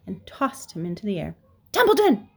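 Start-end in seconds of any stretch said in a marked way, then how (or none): 1.7-2.3 high, loud piglet scream; frantic high yell. A narrator's reading. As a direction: scream the name to wake someone. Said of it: "screamed Wilbur".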